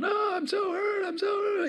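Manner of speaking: put-on high, slow childish wail; whining hurt child. Pastor mocks people whining about their hurt, high childish wail. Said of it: "so many of us are going".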